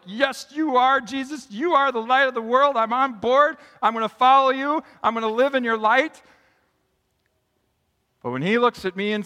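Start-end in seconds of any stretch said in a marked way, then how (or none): 0.0-6.2 eager agreement. Retold or imitated. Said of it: "And I'm like".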